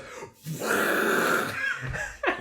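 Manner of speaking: whooshing fire noise; imitated flame burst. Game master voices the flame trap, imitated whoosh. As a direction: mimic a sudden roar of flame. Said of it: "flame just goes".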